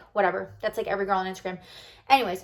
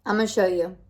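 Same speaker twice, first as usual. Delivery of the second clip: ordinary delivery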